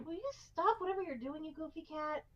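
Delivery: high, soft pet-scolding voice; talking to a pet. Real, not acted. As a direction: fondly scold the cat, high and hushed. Scolding the cat mid-stream.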